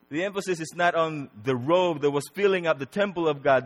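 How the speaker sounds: normal talking voice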